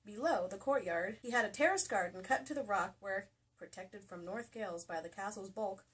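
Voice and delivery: normal talking voice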